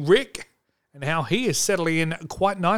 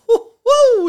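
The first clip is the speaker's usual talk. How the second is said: high gleeful woo; excited whoop; 9 dB louder than usual. Real, not acted. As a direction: whoop with delight. Excited whoop before show.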